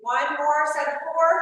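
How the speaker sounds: loud, slow coach announcement; barking gym coach. Real, not acted. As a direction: call the last set out slowly. Coach announcing final set.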